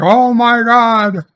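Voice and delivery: high, drawn-out fake gasping reaction; mock shock. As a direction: play the stunned audience in jest. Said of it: "And we're like".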